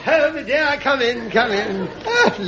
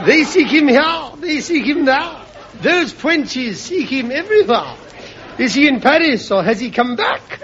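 mock poem recital; 4 semitones higher than usual. Percy recites his rhyme.

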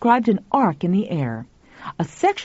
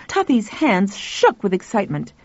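ordinary delivery

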